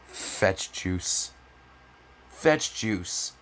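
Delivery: ordinary talk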